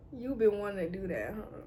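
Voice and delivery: low, soft murmur of embarrassment; hushed reaction. Acted. Reacting inwardly to him touching her hair, quiet and low. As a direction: say it small and under your breath.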